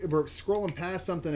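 ordinary talk

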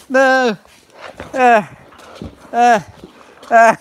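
mock terror screams for the stream; fake dog attack. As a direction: pretend to be mauled by the dog, yelping. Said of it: "You fell for it".

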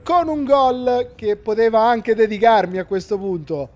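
lively play-by-play football commentary; match commentary. Acted. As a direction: call the match live. Live match commentary over video.

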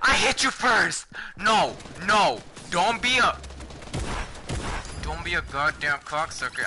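furious shouting; raging gamer. Real, not acted. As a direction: yell at the enemy in rage. Rage at enemy during fight.

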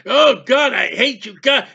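imitated angry outburst; cursing God. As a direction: act out people cursing heaven. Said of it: "they're just cursing God".

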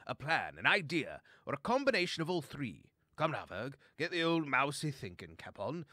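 put-on blustering old-fashioned fellow, pitch leaping up; jolly gentlemanly chum. Bo tells Verg they must free their friends, jolly bluster. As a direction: declare it like a confident old chap. Bo calls for a plan.

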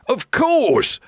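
high, gleeful pirate reply; grinning pirates. A narrator's reading. Pirates welcome hungry Jack, grinning pirate voice. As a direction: agree with a pirate's grin. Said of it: "grinned the pirates".

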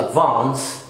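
ADVANCE as "ad-vahnce"; British Advance; British long A. Shown as a demonstration. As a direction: open the A in Advance to "ah". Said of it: "لكن في الأمريكان بنقول".

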